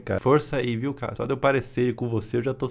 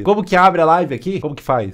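loud, raised-pitch confused question; clueless calling out; 5 semitones higher, 9 dB louder than usual. Acted. Streamer asking his live audience for help, loud clueless question. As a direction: call it out loudly, mocking confusion.

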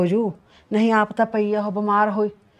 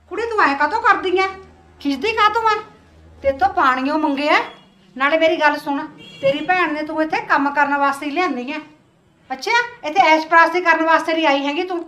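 higher, louder sharp rebuke; mother-in-law berating the visiting sister over the water; harsh scolding; 6 semitones higher, 5 dB louder than usual. Acted. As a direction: put the girl in her place.

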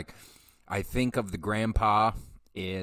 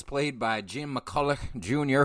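usual speaking voice

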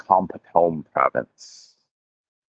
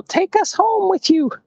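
tiny squeaky voice, much higher; voice of the seeds. Pretend. Voicing the seeds stuck in his socks, a tiny high squeak. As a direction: give the seeds a squeaky little voice. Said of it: "They're like".

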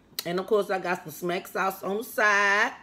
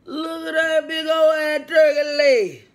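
loud, higher yell about the turkey leg; excited shout; 6 semitones higher, 6 dB louder than usual. Real, not acted. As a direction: shout it out in delight. Shouting over the food.

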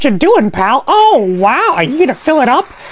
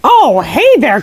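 ordinary talk, nothing marked

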